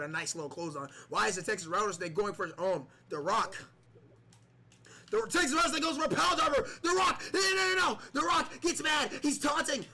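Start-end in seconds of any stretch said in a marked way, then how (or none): 5.1-9.9 excited commentary. Acted. Commentator erupts at big move.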